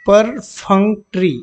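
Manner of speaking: परफंक्ट्री slowly, syllable by syllable; pronunciation demo. Shown as a demonstration. Teacher shows students the pronunciation, परफंक्ट्री slow and segmented. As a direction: say परफंक्ट्री drawn out in separate syllables. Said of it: "pronunciation इसका देख लेंगे".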